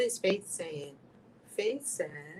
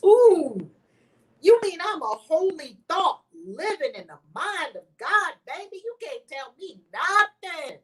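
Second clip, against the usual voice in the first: sassy witch; 8 semitones higher, 9 dB louder than usual. Acted. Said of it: "tell this witch nothing".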